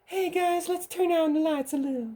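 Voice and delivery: hammed-up high celebrity impression inviting the guys closer. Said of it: "the weird, creepy pedophile voice that [name] was".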